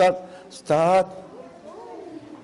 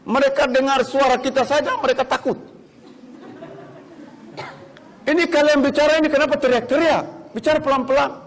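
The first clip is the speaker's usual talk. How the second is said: higher, lively, scoffing; amused child reporting; 7 semitones higher than usual. Retold or imitated. Child tells mother on the phone, higher and amused. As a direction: tell mama the bullies are scared of you. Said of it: "Dia bilang".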